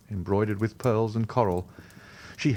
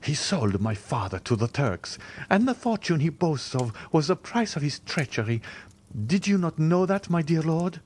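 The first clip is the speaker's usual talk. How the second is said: rising rage; 7 semitones higher than usual. Acted. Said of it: "her eyes flashing with rage".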